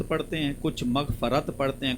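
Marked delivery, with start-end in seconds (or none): 0.9-1.5 मग़फ़रत with "a" after f: magh-fa-rat; mispronunciation. Shown as a demonstration. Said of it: "गलत पढ़ देते हैं". (मग़फ़रत को ग़लती से "मग़-फ़-रत" बोलते हुए)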